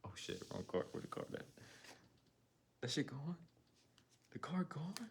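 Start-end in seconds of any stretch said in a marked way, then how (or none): 2.8-4.9 much higher, louder, disbelief; surprised squeal. Real, not acted. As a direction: lose the card and squeak in shock. Card missing, surprised.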